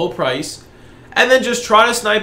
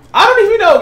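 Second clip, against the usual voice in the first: excited; 13 semitones higher, 7 dB louder, faster than usual. Real, not acted. Repeats, amazed by the crash.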